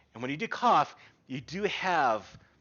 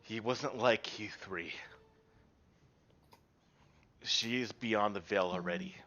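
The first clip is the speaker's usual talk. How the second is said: sad, gentle; 8 semitones lower, 8 dB quieter than usual. Acted. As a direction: softly deliver the death news with sorrow. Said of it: "with sad eyes goes".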